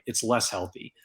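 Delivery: ordinary delivery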